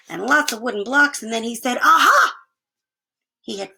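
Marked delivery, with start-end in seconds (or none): 1.8-2.4 high, loud discovery; triumphant aha. Acted. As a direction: cry out as you find it. Arthur finds the pump.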